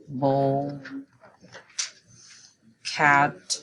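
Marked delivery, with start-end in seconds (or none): none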